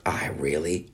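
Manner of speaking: breathy secret whisper, no voice. Real, not acted. Admitting a guilty wish to listeners, whispered. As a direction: confess it in a hushed whisper. Whispered aside about wanting it.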